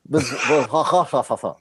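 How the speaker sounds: Washafel slowly dissolving into laughter, no R; laughing through Washafel. Retold or imitated. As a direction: try to say Washafel and crack up. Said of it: "he's constantly calling him".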